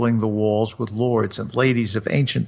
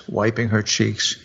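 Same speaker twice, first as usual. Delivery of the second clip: plain reading voice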